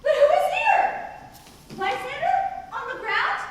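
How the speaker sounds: sudden high, loud alarm; startled. Acted. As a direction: jolt at finding a body. Helena finds Lysander lying there.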